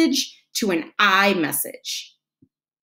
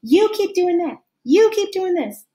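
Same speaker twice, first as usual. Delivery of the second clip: high-pitched mock nagging; acted complaint with every YOU pitched up; accusing; 10 semitones higher, 3 dB louder than usual. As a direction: hammer each repeat as a fresh accusation. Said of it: "instead of saying".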